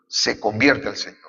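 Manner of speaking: normal talking voice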